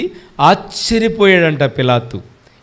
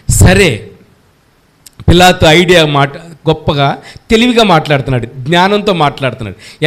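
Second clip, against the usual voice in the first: ordinary talk, nothing marked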